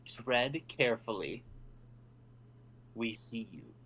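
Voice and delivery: slow, hushed ominous warning; whispering voices. Acted. Game master voices whispers in a player's head, hushed warning. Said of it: "you do hear whispers in the back of your head".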